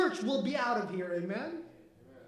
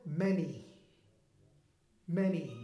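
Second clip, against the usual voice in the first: "many" dropped low and set apart; underlined word; 6 semitones lower, 4 dB quieter than usual. Shown as a demonstration. Pastor makes the congregation underline "many", low and heavy. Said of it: "I want you to underline that word".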